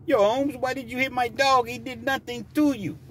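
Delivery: high, hammed-up Mexican-accented protest; put-on Mexican accent. Owner confronting driver, caricatured Mexican accent. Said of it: "There wasn't nothing like that".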